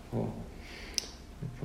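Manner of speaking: usual speaking voice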